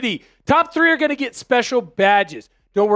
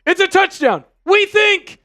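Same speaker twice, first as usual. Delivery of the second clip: real, much higher and louder, bursting with joy; excited touchdown yell; 5 semitones higher, 6 dB louder, much slower than usual. Real, not acted. Streamer celebrating a Seahawks touchdown to his chat, shouting excitedly. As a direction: yell the touchdown like a thrilled fan. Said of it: "Sorry if I blew out your speakers. I'm just excited".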